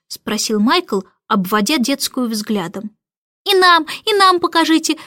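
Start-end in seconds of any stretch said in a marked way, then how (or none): 3.4-5.0 put-on higher, loud eager kids' plea; clamouring. A narrator's reading. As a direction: beg noisily like little twins. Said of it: "зашумели близнецы".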